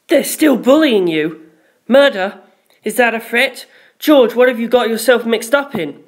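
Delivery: high, urgent questions; rattled and accusing; alarmed. A narrator's reading. Lisa Lisa alarmed, jumping to conclusions.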